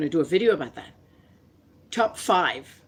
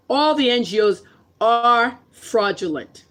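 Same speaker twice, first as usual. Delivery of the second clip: louder, higher and slow, every word hammered; emphatic outburst; 6 semitones higher, 7 dB louder, slower than usual. Real, not acted. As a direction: slam it down word by word. Angry rant about NGOs.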